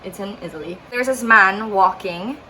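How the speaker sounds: ordinary talk, nothing marked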